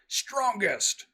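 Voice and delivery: high boasting bellow, lifting the stones; proud giant booming his own name; boastful giant shout. Acted. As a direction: roar it with pride.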